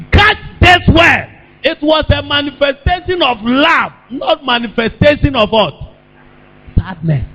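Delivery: high, forceful pulpit proclamation; emphatic preaching. Real, not acted. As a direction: drive the point home to the congregation. Preacher drives the point home.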